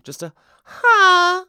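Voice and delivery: high, loud, slow moaning ha; loud demo moan. Shown as a demonstration. Showing listeners in their car how to moan, loud high. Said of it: "just moan".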